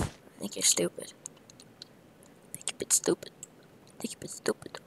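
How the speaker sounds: soft breathy mocking whisper; whispered taunt. Gamer whispering insults at a self-defeating player. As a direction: murmur the insult slyly.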